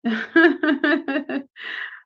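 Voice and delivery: a real burst of laughter at the chat; amused laugh. Real, not acted. Teacher reacting to viewers' orchid idea, laughing. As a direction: laugh it off, caught off guard.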